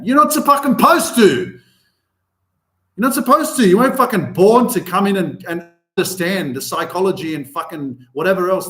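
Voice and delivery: loud, high and fired up; loud exasperated rant; heated rant. Real, not acted. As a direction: tell them off hard. Ranting at people judging parents.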